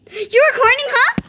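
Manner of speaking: surprised. Real, not acted. Catches someone filming.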